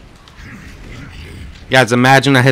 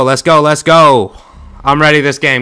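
normal talking voice

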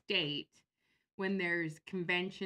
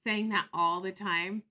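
usual speaking voice

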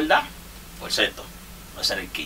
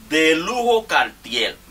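announcer call; 5 semitones higher, much slower than usual. Pretend. Announces next batter like ballgame.

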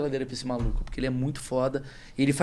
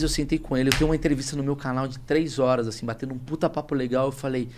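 ordinary talk, nothing marked

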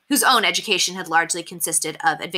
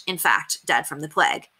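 ordinary delivery, nothing marked

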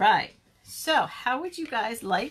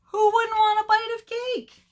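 high, loud storybook character; indignant defence; 12 semitones higher, 7 dB louder than usual. Acted. Character defends cake as bait.

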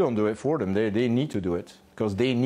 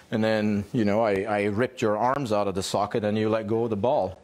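normal talking voice

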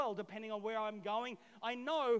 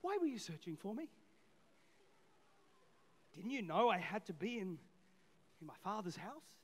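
softer and lower, calm; gentle reply; 5 semitones lower, 5 dB quieter than usual. Retold or imitated. Jesus gently answering his mother, soft and calm. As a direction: answer kindly, no fuss. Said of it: "Jesus just gently replies".

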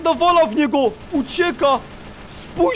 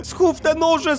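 ordinary talk, nothing marked

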